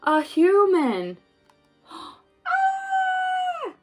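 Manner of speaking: plain reading voice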